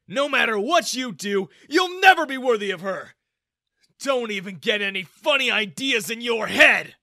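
high, very loud warning; threatening father. A narrator's reading. Father warns the young man away from his daughter, loud and threatening. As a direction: threaten him hard.

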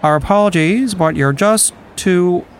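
cheerful airline announcer with terrible news; airport announcement. Pretend. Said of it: "I think it's gonna be more like".

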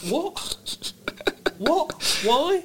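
very high-pitched, incredulous questioning; baffled squeak. Acted. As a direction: squeak each word out in mock bewilderment. Comic bafflement at Galloway's candidacy.

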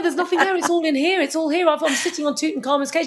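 high and fast, calling everyone over; thrilled, beckoning the others from far off; excited explorer. Retold or imitated. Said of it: "excited explorer calling others".

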